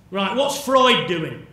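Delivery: thinking aloud, loudly and emphatically; brisk decision-making. Acted. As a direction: act out a loud, eager search for options. Voicing family choosing a doctor.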